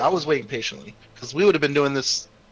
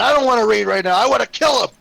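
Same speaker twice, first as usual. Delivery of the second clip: loud, raised-pitch angry protest; fuming; 7 semitones higher, 6 dB louder than usual. Acted. Enraged Taurus refuses Orin and wants to kill, fuming. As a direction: push it out high and hard, refusing. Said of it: "I'm fuming".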